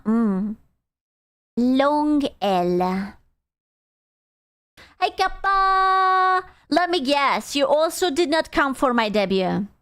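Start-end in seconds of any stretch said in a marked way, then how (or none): 5.0-6.4 high, stretched-out hello; calling out greeting. Real, not acted. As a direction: sing out the viewer's name, long and high. Greeting a viewer in chat.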